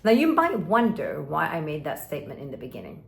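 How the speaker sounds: ordinary delivery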